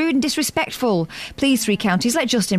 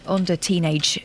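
usual speaking voice

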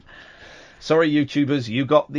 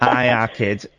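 hammed-up Manchester accent; 5 semitones lower, slower than usual. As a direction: lay on the Manchester accent thick. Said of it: "Congratulate me on my Manchester accent".

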